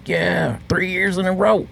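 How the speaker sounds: high-pitched mock bragging; boastful cook-off winner. Chili champion boasting to everyone, high-pitched. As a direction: crow about the winning streak.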